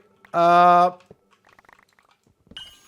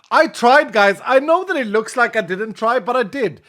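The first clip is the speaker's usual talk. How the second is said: higher, louder defensive protest; 8 semitones higher, 10 dB louder than usual. Real, not acted. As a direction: insist you really tried. Defending himself to chat.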